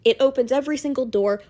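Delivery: ordinary talk, nothing marked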